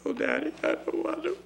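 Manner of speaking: a hint of a tearful, breathy child; tearful little girl. Crying girl refuses to turn to daddy, tearful.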